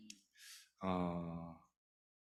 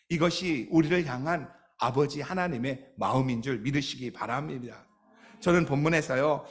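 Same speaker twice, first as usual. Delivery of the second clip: normal talking voice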